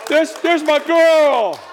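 put-on a father beaming at his child; proud father. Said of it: "God the Father looks and says".

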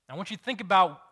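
preacher hammering the point home; emphatic repeat. Shown as a demonstration. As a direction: push "think" up hard the second time. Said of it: "Now I want you to think about that".

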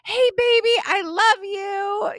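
high-pitched gushing greeting, slowing on the love; squealing. Retold or imitated. Big sister gushing to little sister on screen, squealing. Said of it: "She starts squealing".

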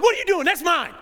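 loud, high-pitched pretend outburst; mock confrontation. Preacher pretending to grab communion, loud mock demand. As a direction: act out snatching what is yours. Acted snatching of communion cup.

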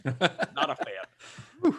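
drawn-out, slow repeat with a laugh; slow echo. Acted. Host echoing his verdict on the review, slow and amused. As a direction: stretch it out, amused.

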